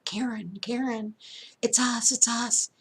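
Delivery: high-pitched acted plea at a door; urgent calling out. Woman pounding on a neighbor's door for help, urgent calling. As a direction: call out urgently, pitched well up.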